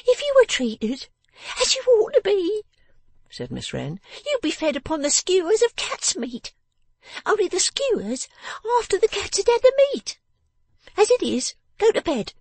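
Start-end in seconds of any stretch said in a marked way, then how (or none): 0.0-2.6 very high, loud, scolding little voice; bossy person of the house. Acted. Miss Wren orders her father to hand over money, bossy and high-pitched. 4.2-12.3 very high, loud little scold; scolding person of the house. Acted. Miss Wren sends her father to bed, scolding and high.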